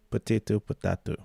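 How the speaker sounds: normal talking voice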